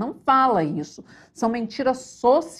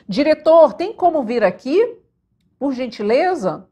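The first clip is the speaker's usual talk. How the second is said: loud, higher-pitched call to someone off set; 4 semitones higher, 6 dB louder than usual. Real, not acted. Teacher calls the director over to fix the slide, calling out. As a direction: raise your voice to summon the director.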